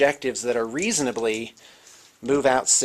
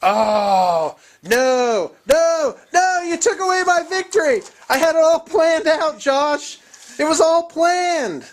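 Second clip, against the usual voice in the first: high, loud wailing villain despair; hammed-up anguished defeat; mock despair; 8 dB louder, slower than usual. Loses victory to fleet roll.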